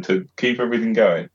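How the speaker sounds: ordinary talk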